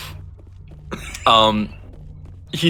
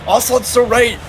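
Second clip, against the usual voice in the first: excited outburst; 11 semitones higher than usual. Real, not acted. Reacting loudly to chat.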